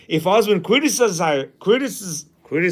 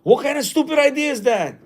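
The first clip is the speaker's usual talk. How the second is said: dismissive scorn; 7 semitones higher than usual. Retold or imitated. Husband dismissing idea.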